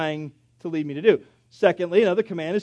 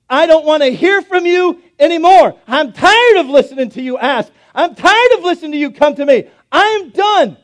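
much louder, higher exasperated rant; fed-up God; 8 semitones higher, 11 dB louder than usual. Pretend. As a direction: vent that you are sick of them.

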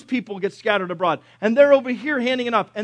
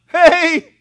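high, loud comic holler; calling out; 9 semitones higher, 9 dB louder than usual. Acted. Third captain yelling up to Elisha that he believes, comic holler. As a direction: shout up a hasty surrender, hammed-up.

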